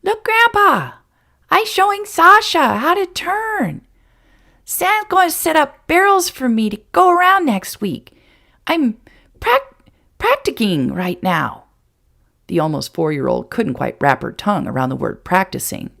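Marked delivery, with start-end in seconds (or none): 0.0-11.6 put-on high, loud toddler voice calling out; girl calling to her grandfather from her pony; happy little girl shouting. A narrator's reading. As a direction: call out proudly like a small child. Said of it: "she shouted happily".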